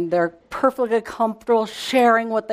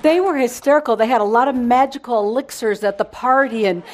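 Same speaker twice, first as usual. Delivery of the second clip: ordinary talk, nothing marked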